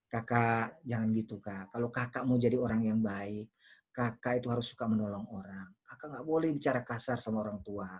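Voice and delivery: put-on low, soft, tender talk to a child; soothing parent, quietly coaxing; gentle parental intonation. Shown as a demonstration. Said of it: "kita harus pakai intonasi yang baik".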